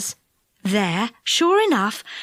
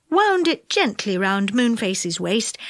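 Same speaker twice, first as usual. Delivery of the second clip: usual speaking voice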